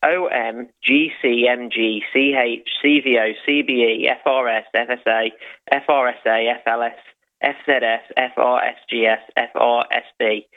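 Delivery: normal talking voice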